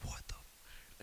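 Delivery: a breathy whispered what the f; hushed whisper. Retold or imitated. Waking in a stranger's hotel room, whispering in shock. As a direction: whisper it, confused and alarmed.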